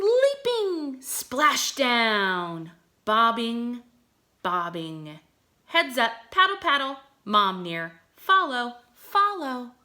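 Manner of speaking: high, bouncy read-aloud of a duckling poem; expressive poem reading. Acted. Teacher reading duckling poem to her class, animated and sing-song. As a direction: act out each little word for the kids.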